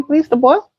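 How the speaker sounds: ordinary delivery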